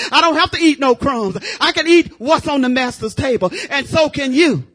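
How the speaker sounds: loud, driving sermon voice; full-force preaching. Acted.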